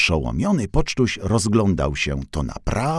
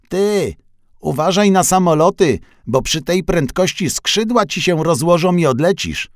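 higher, louder little van retort; cheeky comeback; 9 semitones higher, 5 dB louder than usual. A narrator's reading. Pocztuś fires back at the departed truck, higher and louder. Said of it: "Odgryzł się, ale już sam do siebie".

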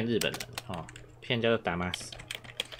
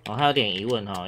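ordinary delivery

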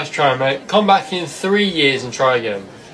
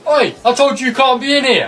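angry call; 8 semitones higher, 4 dB louder than usual. Acted. Staff member catches the kid.

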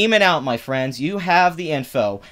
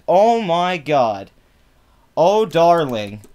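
mock exasperation; 2 semitones higher, 5 dB louder, much slower than usual. Real, not acted. Reacting to the tweet in disbelief.

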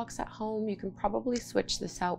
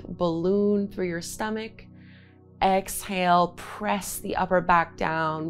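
guided breathing cues. Acted. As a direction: call out each inhale and exhale.

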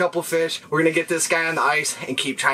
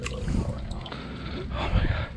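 awed whisper; 9 dB quieter, much slower than usual. Real, not acted. Awed whisper during fight.

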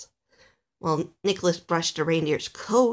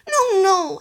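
loud, high protest; panicked cry; 13 semitones higher, 8 dB louder than usual. A narrator's reading. Alistair being carried to Santa's house, panicked. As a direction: cry out in alarm as a small elf. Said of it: "Alistair cried".

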